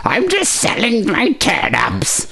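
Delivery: high, slow caricature of the caller; mock English caller. Host mocking the caller as a turnip seller, high put-on voice. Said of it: "sounded like she was calling from the back of a fucking turnip truck".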